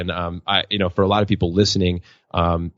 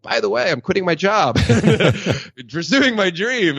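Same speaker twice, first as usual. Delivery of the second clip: much higher, breezy announcement broken by a laugh; laughing confession; 10 semitones higher than usual. Retold or imitated. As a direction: blurt it to your new wife with a sheepish laugh. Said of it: "I was like".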